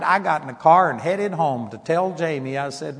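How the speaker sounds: ordinary delivery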